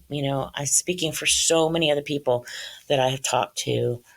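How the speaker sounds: ordinary delivery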